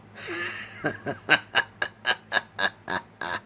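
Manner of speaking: drawn-out villainous staccato cackle, starting high; long horrifying cackle. A narrator's reading. Clown replies to terrified Isaac, horrifying cackle. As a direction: cackle on and on, slowly descending. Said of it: "a long, horrifying cackle".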